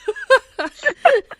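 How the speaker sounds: giggling sheepishly; sheepish high laugh; high embarrassed laugh. Real, not acted. Embarrassed laugh after name slip.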